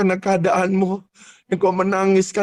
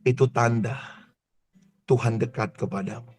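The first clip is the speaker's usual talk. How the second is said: hushed altar-call preaching; 8 semitones lower than usual. Acted. Soft, intimate altar-call preaching.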